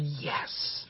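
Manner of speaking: gloating whisper. Pretend. Satan gloating again.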